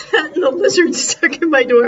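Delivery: loud laughing aside. Real, not acted. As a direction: laugh out loud at the whole mess. Laughing at her animal day.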